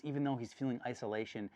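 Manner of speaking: ordinary talk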